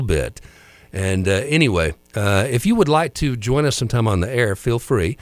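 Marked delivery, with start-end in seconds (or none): none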